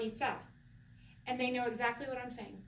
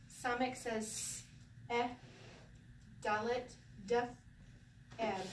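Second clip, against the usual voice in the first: ordinary delivery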